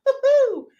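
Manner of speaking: high, loud cheer; real excited whoop. Real, not acted. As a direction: whoop for the song. Cheers about favourite band.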